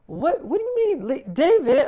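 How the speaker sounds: high, startled "what do you mean"; shocked disbelief. Retold or imitated. Wife startled when David says leave her stuff, high shocked question. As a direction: react as if the order makes no sense.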